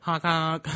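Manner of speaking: high clown honk. Pretend. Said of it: "I'm Boo Boo the Friend Clown".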